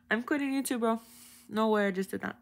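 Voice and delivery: giggling fake quit; laughing. Real, not acted. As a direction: laugh out the mock resignation.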